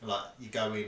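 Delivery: usual speaking voice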